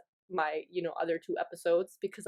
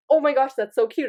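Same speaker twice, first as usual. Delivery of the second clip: louder, delighted gush; delighted reaction to a friend's couple photo; 5 semitones higher, 7 dB louder than usual. Retold or imitated. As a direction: squeal over a cute photo. Gushing over friend's couple photo.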